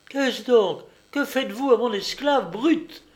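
high, loud, sour scolding; acrid, indignant woman's voice; sour, sharp. A narrator's reading. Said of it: "dit-elle avec aigreur".

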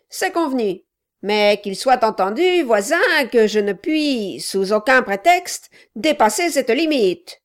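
a loud, pushy little man; gruff gravelly dwarf voice. Acted. Quilp speaks.

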